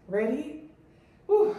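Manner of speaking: normal talking voice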